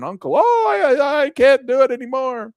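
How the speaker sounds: hammed-up wail of surrender; mock scream. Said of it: "you start screaming".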